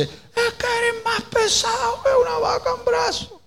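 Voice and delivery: very high-pitched strained yell; acted-out marital shouting; mock shouting. Shown as a demonstration. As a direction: holler it at someone right beside you. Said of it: "Y le estás gritando".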